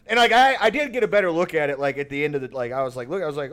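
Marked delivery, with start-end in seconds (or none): none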